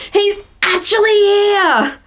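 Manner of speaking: high, slow squeal of delight; =hammed-up overjoyed gush; excited inner voice. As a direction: act out thrilled disbelief. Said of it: "someone's internal voice being like".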